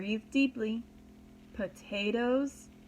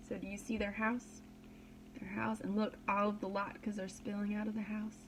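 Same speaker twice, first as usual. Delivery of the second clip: hushed aside; 2 semitones lower, 10 dB quieter than usual. Real, not acted.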